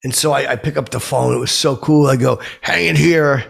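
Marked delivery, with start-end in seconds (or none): 2.6-3.4 retold video greeting. Retelling his excited video intro.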